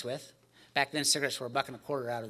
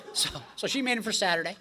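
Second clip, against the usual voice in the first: usual speaking voice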